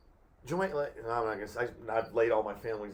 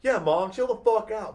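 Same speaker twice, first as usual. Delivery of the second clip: higher, louder snapping back; retold yell at mom; 10 semitones higher, 9 dB louder than usual. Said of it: "And I was like".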